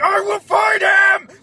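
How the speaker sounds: high, loud, slow bellow; furious shout. Retold or imitated. Zod shouting in the Krypton courtroom, furious. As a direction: roar it with rage. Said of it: "He's like".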